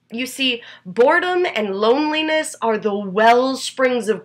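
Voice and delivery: deliberate recital building to loud, urgent peaks, then easing off; slam poetry. Acted. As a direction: perform it as a slam poem, with weighty pauses. Performs own slam poem.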